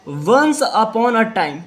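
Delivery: very slow, each word drawn out; slow dictation. Shown as a demonstration. Teacher showing pupils how to read, very slow. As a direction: stretch every word for the class. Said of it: "आपको repeat करना है".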